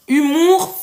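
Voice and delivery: normal talking voice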